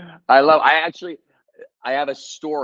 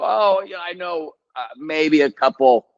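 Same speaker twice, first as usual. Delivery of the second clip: high, hedging retreat; sheepish backpedaling; 7 semitones higher than usual. Retold or imitated. Actor retelling his reply about card tricks to the director, backpedaling. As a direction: hedge it, starting high and coming down. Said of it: "I started backpedaling".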